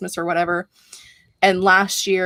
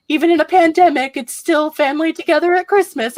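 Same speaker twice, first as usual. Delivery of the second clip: mock tearful; 10 semitones higher, 5 dB louder than usual.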